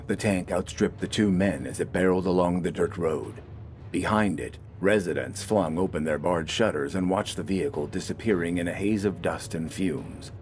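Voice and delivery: ordinary delivery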